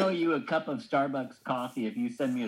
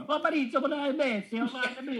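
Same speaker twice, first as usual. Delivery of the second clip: mock pleading falsetto; 14 semitones higher than usual. Pope jokingly pleading with Messi on the phone, high falsetto. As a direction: ham up a lovesick caller in falsetto.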